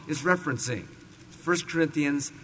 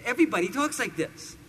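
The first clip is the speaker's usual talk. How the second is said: mock New Jersey accent, climbing higher; 6 semitones higher than usual. Shown as a demonstration. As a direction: put on a caricature Jersey accent. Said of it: "When they talk like this".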